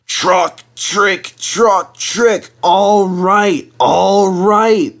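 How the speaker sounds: high, loud rhythmic clatter-chant; grudging vans. Acted. Vans answering Henry grudgingly in a high clattering chant. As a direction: grumble it in time with the wheels. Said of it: "they answered grudgingly".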